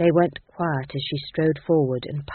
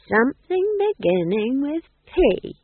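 put-on slow, teasing old woman's voice; old witch playing I spy; 8 semitones higher, 4 dB louder, slower than usual. Nanny Ogg teasing the ghost king with I spy, slow and sly. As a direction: draw out the game, much slower.

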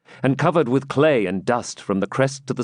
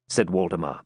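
ordinary delivery

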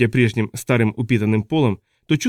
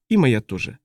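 plain reading voice

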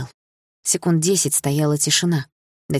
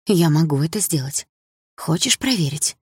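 venomous tenderness. Acted. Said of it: "с той же ядовитой нежностью".

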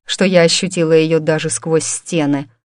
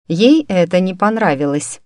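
ordinary delivery, nothing marked

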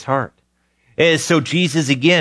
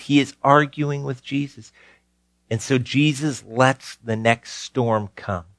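normal talking voice

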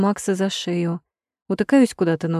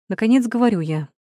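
usual speaking voice

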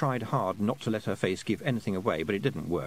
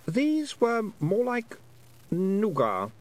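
put-on high, lightly boyish voice, puzzled; a lost boy working out how long he's been away; young boy Roland, unsure; 11 semitones higher, much slower than usual. A narrator's reading. Lost boy telling Tiffany about time, high and puzzled.

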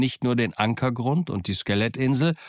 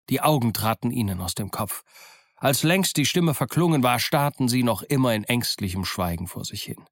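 plain reading voice

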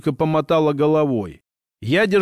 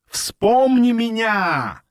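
slow, high distorted roar; eldritch horror; 6 semitones higher, much slower than usual. A narrator's reading. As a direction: drag it out like a warped creature. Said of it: "ревел искажённый голос".

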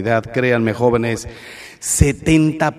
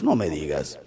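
sarcastic dismissal; 10 semitones lower, 8 dB quieter than usual. Real, not acted. Sarcastic reply to claim.

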